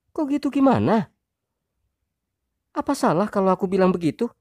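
high, loud, fast wife voice; defensive wife. Acted. Wife snapping back at husband, high fast voice. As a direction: fire back quickly as the wife.

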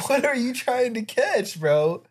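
high-pitched mock disbelief; incredulous question. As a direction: ask it high, like baffled heckling. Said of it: "And it's like, bro".

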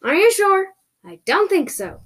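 high, loud challenge easing off; screamed retort. Acted. Carried-off captive doubting the rescue, screamed retort. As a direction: yell back in disbelief. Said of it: "I screamed at them".

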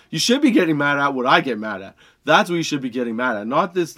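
ordinary delivery

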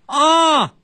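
very high hollering of an oblivious deaf granny; 耳背奶奶大嗓门. Acted. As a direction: shout it as if nobody can hear you. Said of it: "自己说话呀特大声". (耳背奶奶高声大喊，自己没感觉)

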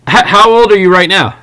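ordinary talk

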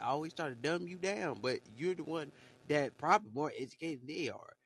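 ordinary talk

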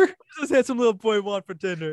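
put-on doting mom voice to a kid; mom talking to child. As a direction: coo like a mom to a little boy. Mimicking a teacher or mom.

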